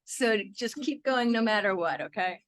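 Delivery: an upbeat leader explaining a group song; rally the group to join in; teaching a song. Acted. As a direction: warm song leader setting up.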